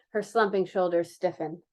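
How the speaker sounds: plain reading voice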